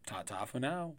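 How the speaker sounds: ordinary talk, nothing marked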